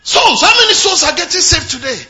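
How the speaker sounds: loud, high fervent preaching; preaching at full force. Acted. Pastor urging the congregation to win souls, fervent and loud. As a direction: drive the point home with fire.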